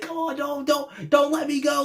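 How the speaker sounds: mocking Wheeler as he begs to be held onto; Titanic plea. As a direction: ham it up like the Titanic farewell. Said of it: "some Titanic kind of shit".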